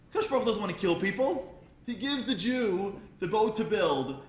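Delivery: high, loud, driving sermon voice; a rabbi rousing the crowd about Noah, fervent preaching. Acted. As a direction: preach it to the rafters. Preaching at full force.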